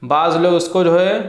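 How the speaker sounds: ordinary talk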